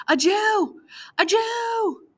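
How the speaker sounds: put-on high, loud child's shout; children shouting. Polish children spotting Vladek, shouting high and loud. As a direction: yell to raise the alarm.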